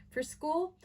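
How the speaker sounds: high-pitched rising question; incredulous echo. A narrator's reading. Aunt doubting the boys' school excuse, incredulous. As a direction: throw it back in disbelief. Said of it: "raising her eyebrow so high".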